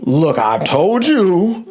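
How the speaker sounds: deliberate, drawn-out parental telling-off; responsible daddy. Retold or imitated. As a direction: lay it down like a dad acting strict. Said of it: "I got to be the responsible daddy".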